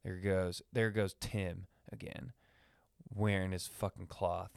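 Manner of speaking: quiet, disdainful gossip; judgy. Pretend. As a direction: sneer under your breath. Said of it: "cavemen and women were just judgy".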